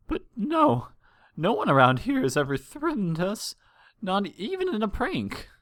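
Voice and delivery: high, trembling voice of a frightened mare; a worried mother holding back tears; tearful mother. Acted. Frightened mother telling the princesses of her missing son, high and tearful.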